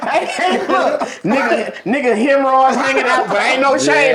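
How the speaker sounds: high, laughing through the words; cracking up while pointing it out. Real, not acted. Laughing at a friend's gross joke on the podcast.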